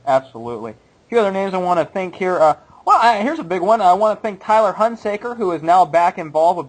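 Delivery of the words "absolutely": ordinary delivery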